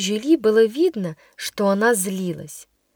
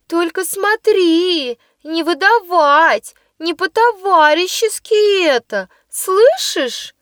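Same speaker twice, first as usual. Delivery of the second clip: high, slow insistent warning; threatening schoolgirl; 7 semitones higher, 3 dB louder, slower than usual. Acted. Plump girl warning the new pupil, high slow threat. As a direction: warn her high and slow not to tell. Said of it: "Подскочила ко мне толстенькая".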